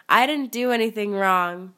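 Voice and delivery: usual speaking voice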